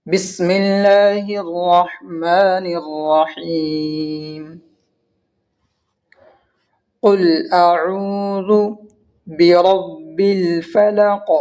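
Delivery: very slow tajweed recitation, long held vowels; measured, chanted Arabic recitation; Quran recitation. Acted. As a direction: recite slowly and melodically, stretching each long vowel. Recites the verse being taught.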